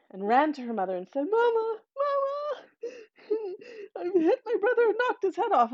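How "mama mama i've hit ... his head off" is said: put-on much higher, wailing child crying for mama; crying and screaming. Little girl running to her mother, wailing and crying. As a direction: sob it out in a panicked child's wail. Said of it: "began to cry and scream".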